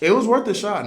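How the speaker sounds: voicing mom, high and loud.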